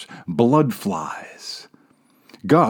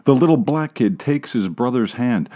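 usual speaking voice

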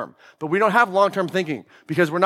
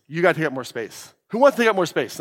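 ordinary talk, nothing marked

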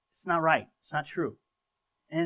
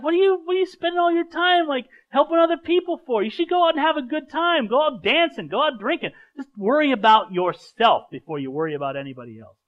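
mocking friends; 9 semitones higher, 5 dB louder than usual. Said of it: "And you'll be like".

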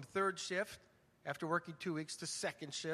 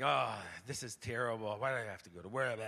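grumpy complaint; 8 semitones lower than usual. Retold or imitated. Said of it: "I stop barking".

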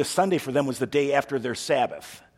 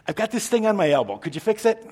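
higher, quick pleading request, lightly acted; imitated favour-seeker; 7 semitones higher, 4 dB louder than usual. Someone asking Jesus to fix an elbow, higher and quick. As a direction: lightly voice a hopeful petitioner, pitched up.

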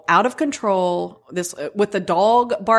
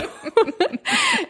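high laugh. Real, not acted. Laughing at Bridgerton remark.

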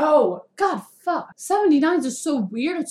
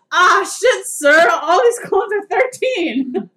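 louder, higher, slowed-down report; mock bouncer; 7 semitones higher, 5 dB louder, slower than usual. Doorman reporting to the guard about clone IDs, loud. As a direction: play the startled doorman. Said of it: "I literally said".

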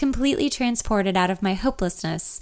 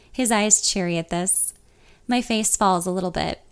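ordinary delivery

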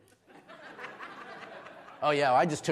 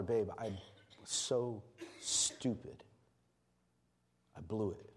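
hushed broken confession; 5 semitones lower, 9 dB quieter, slower than usual. Pretend. Imagined confession to his wife.

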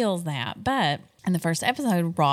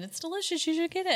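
high, bright quoted friends; quoting friends; 12 semitones higher, 4 dB quieter, faster than usual. Retold or imitated. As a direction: voice the friends recommending it. Friends' advice voiced high.

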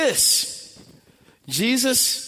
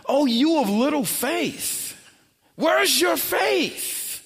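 rebuke; 6 semitones higher, slower than usual. Acted. Jesus rebukes the disciples.

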